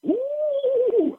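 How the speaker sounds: high squeal of shock; hammed-up high "Ooh"; mock gasp. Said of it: "You go".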